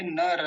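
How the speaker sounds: नर drawn out as na-ra; full final र. Shown as a demonstration. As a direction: finish नर on an open, voiced a. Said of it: "अभी क्या बोलेंगे". (नर, र को पूरा बोलकर)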